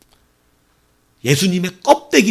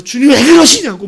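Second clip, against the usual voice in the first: much higher, louder indignant outburst; angry protest; 12 semitones higher, 9 dB louder than usual. Retold or imitated. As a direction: protest hotly at the master. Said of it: "막 화를 내면서".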